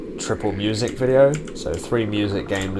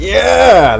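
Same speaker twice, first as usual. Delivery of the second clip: triumphant yell; 14 semitones higher, 15 dB louder than usual. Real, not acted. Relief after close call.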